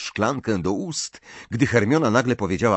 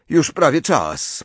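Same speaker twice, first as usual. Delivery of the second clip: breezy, higher and drawn out; carefree; 7 semitones higher, 4 dB louder, slower than usual. A narrator's reading. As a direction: announce it lightly, without a care. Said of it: "powiedział beztrosko Harry".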